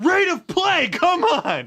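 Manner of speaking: high, loud impatient shout; angry shout. Pretend. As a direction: shout to hurry them up. Yelling like angry staff.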